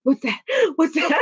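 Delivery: a touch of high, jumpy mock fear; child alone in bed, lightly acted fear; mock fright. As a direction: startle at every sound in the dark. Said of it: "I was in my bed like".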